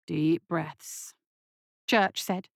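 low, soothing murmur; calming. Acted. Doctor calming a shaking nurse, soothing. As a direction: settle someone's nerves gently.

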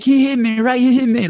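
high, mock-hurt complaint to the ref; fake wounded cry. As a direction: play up the fake injury, whining high. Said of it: "I'm screaming".

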